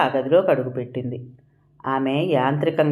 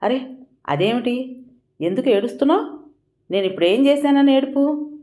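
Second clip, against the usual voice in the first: higher, startled and anxious questions; alarmed; 9 semitones higher than usual. A narrator's reading. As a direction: voice jumps up in alarm. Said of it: "Jagadish హడలిపోయాడు".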